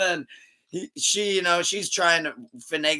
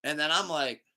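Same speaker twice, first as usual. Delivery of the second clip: ordinary talk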